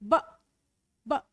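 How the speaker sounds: "ब" as a short plain b sound; letter sound. Shown as a demonstration. Teacher sounding out letter B for pupils. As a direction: clip "ब" short and crisp. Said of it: "B का sound".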